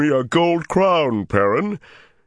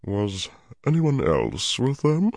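too casual; 8 semitones higher, slower than usual. Acted. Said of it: "In a voice that was low and much too casual".

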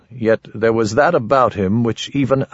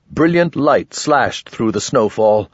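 ordinary delivery, nothing marked

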